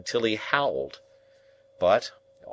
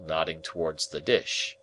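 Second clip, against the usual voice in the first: ordinary delivery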